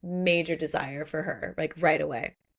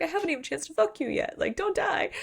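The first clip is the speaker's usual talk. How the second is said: high, fast acted protest; mock-frantic squeaky complaint; upset pleading; 9 semitones higher, faster than usual. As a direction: plead it in a raised pitch. Axel upset after she nearly drowns.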